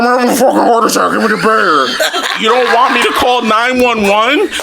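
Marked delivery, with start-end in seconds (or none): 0.0-1.9 rushed, slurred mock-dad plea; mumbled impression of dad begging for medicine; imitating garbled dad. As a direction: garble the words as dad in a panic. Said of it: "I can't understand you".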